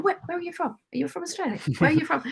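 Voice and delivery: high-pitched eager rush of questions; eager excited questions. Retold or imitated. Australian abroad eagerly greeting another Aussie, high and excited. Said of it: "I'm like a beacon. I run straight to them".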